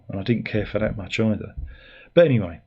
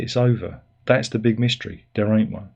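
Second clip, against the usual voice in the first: ordinary talk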